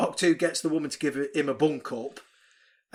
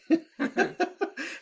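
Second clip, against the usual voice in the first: high laugh; 14 semitones higher than usual. Real, not acted. Laughs at self.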